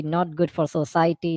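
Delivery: ordinary delivery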